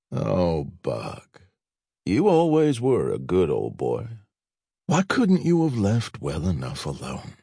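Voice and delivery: a hint of a menacing southern gent; stranger's voice. A narrator's reading. Stranger's voice, held.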